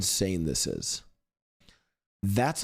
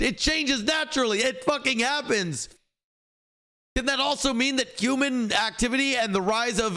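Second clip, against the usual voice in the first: furious rant; 10 semitones higher than usual. Real, not acted. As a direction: tear into him, pitch way up, fed up. Ranting back at a chat troll.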